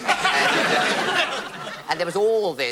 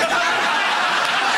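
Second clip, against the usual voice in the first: breathy loud burst of laughter; 6 dB louder than usual. Real, not acted. Telling of the ruined song, laughs out loud. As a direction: laugh hard at the memory.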